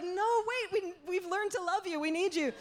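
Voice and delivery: high, panicky mock begging; hammed-up desperate plea; pleading. Group begs butcher not to leave.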